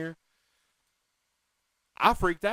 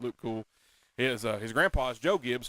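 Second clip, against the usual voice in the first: ordinary talk, nothing marked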